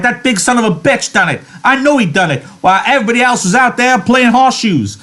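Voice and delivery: building fury. A narrator's reading. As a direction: whip yourself into a rage. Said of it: "He worked himself into a fury".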